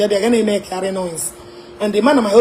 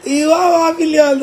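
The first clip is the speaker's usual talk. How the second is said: higher, louder incredulous question; mock disbelief; 7 semitones higher, 3 dB louder than usual. Mocking the money request, loud disbelief. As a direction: act shocked at the sum. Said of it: "mock disbelief".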